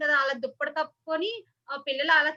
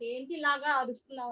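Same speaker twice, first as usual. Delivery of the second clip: normal talking voice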